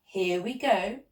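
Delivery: usual speaking voice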